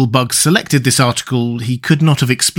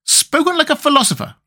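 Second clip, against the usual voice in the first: much higher, admiring exclamation; cried out; 14 semitones higher than usual. A narrator's reading. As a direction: exclaim with delight. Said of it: "cried the Wogglebug".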